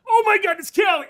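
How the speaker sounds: high, full-volume shriek of recognition; excited shout. Retold or imitated. Shopper suddenly recognizes the singer in a store, excited shout. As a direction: shout it out in stunned, thrilled recognition. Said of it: "I shouted out in the".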